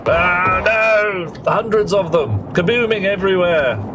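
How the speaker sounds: alarmed. Real, not acted. Sudden explosions on screen.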